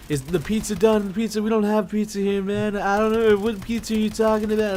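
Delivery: high, loud mock-frantic shouting; retold screaming. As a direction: yell it like waking in a panic. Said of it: "I wake up screaming".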